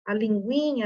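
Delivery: ordinary talk, nothing marked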